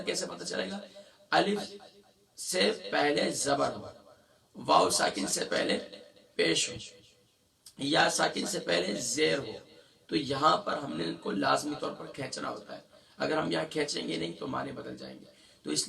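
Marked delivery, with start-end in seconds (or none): none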